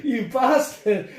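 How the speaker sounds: high, loud words broken up by real laughter; laughing. Real, not acted. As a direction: laugh helplessly as you call him a bastard. Laughing at friend's joke.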